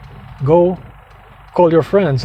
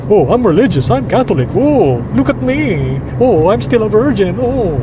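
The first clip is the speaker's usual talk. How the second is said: mock pious virgin; 4 semitones higher than usual. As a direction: mimic a holier-than-thou churchgoer, pitched up. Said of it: "present herself to the public like some fucking".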